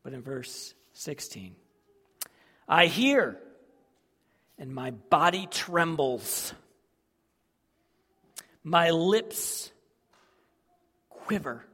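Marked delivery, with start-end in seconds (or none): 2.7-11.6 fearful outcry. Acted. Voicing trembling prophet.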